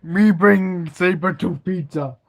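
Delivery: loud grunting caveman. Pretend. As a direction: bellow it like a proud caveman. Caveman talk game, laughing.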